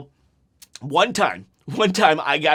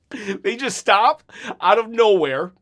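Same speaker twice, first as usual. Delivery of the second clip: normal talking voice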